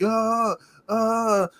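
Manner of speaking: high mock yelps; mock running yell. The Foundation running out again, high mock yelps. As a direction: yelp like a tiny sprinting wrestler. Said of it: "sprinting, like".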